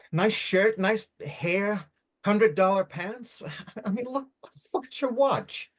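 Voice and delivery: high, scornful mockery with a laugh; mocking a rich stranger, pitch up, slow on the pants. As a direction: sneer at his fancy clothes, laughing. Mocking his clothes and watch.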